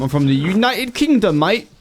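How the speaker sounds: hammed-up British. Answers chat asking where from.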